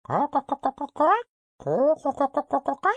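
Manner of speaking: very high, rapid-fire cawing; shrill hen cackle. A narrator's reading. Hen suddenly cries out under the throne, shrill cackling. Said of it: "a shrill voice suddenly cried".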